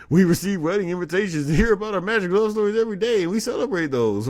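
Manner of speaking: loud, high, rapid-fire corporate parody; mock spokesperson. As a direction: race shrilly through the canned company line. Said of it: "this is how they sound in my head".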